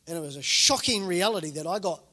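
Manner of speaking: ordinary talk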